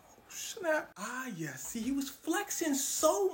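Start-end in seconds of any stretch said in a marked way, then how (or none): 0.1-0.9 whispered oh snap. Retold or imitated. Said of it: "He said".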